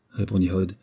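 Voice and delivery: ordinary delivery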